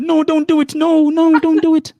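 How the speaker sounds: very high, loud mock plea; exaggerated high-pitched meme voice; meme imitation. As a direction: squeal it like a meme being quoted. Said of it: "Have you seen that meme?".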